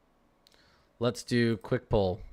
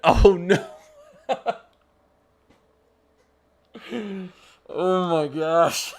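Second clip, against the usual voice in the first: loud, high laughing oh no; laughing disbelief; real laughing; 7 semitones higher, 7 dB louder, much slower than usual. Real, not acted. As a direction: laugh through the words. Laughing at carding story.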